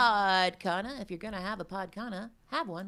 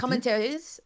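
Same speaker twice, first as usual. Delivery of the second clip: put-on playful French accent; 5 semitones higher, 5 dB louder than usual. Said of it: "say something in an accent".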